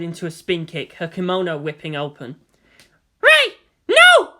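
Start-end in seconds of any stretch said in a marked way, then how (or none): 3.2-4.3 much louder and higher, desperate cry; shout. A narrator's reading. Asuka trying to stop Ray's kick, a loud high cry. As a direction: scream to stop her. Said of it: "Asuka shouted".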